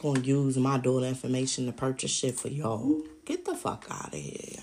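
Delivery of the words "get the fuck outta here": whispered get-out, much softer and voiceless; hissed dismissal. Real, not acted. Whispering a get-out to the family who cheated her. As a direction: spit it out under your breath.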